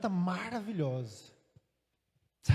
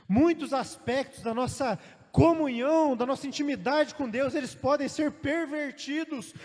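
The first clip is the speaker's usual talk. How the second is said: full-force preaching; 5 semitones higher, 5 dB louder than usual. Acted.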